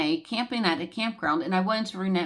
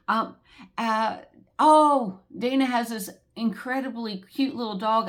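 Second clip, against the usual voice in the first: ordinary talk, nothing marked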